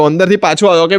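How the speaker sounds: ordinary talk, nothing marked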